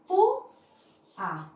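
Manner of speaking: pu, then a, split into two separate syllables. Shown as a demonstration. Teacher sounding out a word for students, syllable by syllable. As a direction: sound out pu and a apart, slow and deliberate. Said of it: "En este caso".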